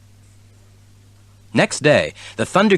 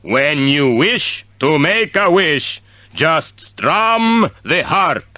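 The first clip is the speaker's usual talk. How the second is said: genie voice; 4 semitones higher, slower than usual. A narrator's reading. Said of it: "The genie's voice spoke".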